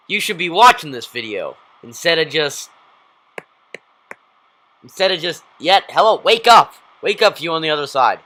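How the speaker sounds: playful shouting to rouse someone; mock wake-up call. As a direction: holler at dozing viewers. Calling to dozing viewers, wake up.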